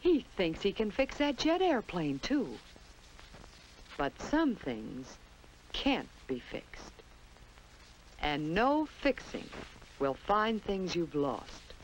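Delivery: usual speaking voice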